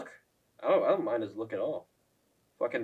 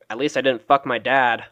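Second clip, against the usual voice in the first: normal talking voice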